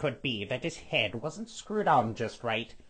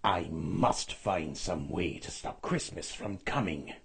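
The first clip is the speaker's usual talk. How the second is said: low growl, scheming; low growling Grinch; 7 semitones lower, 3 dB quieter than usual. A narrator's reading. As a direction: growl it like a plotting grump. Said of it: "Then he growled".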